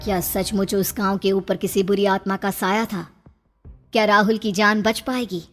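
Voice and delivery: plain reading voice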